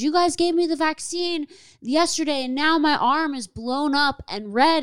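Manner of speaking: imitating the woman's distressed plea; high distressed mock complaint; upset woman. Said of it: "She goes".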